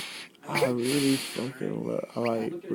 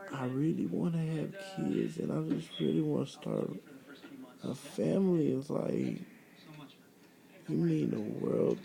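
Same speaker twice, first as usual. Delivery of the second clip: ordinary talk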